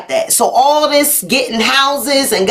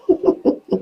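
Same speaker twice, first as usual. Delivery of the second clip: breathy, voiceless chuckle; laughter; 4 dB quieter than usual. Real, not acted. Laughs amid her silly song.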